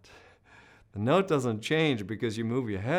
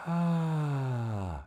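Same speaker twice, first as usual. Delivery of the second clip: breathy ah; 3 semitones higher than usual. Shown as a demonstration. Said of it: "for you to do breathy".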